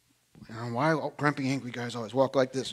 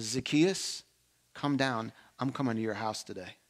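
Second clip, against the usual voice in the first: gentle call; 4 semitones lower, 8 dB quieter than usual. Acted. Jesus voiced gently in sermon.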